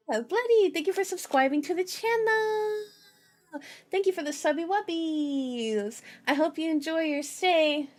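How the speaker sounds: high, sing-song subscriber thank-you; gushing thanks. Real, not acted. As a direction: gush sweetly, pitched well above normal talk. Streamer thanking a new subscriber.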